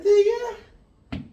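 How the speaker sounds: drawn-out high shout of success; victorious yell when the answer works; triumphant cry. Real, not acted. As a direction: crow it out slow and loud. Teacher finishes solving problem.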